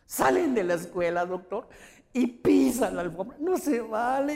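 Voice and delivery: mock crying protest; imitating tearful whining. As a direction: wail the complaint like a hurt mother. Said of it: "se pone a chillar".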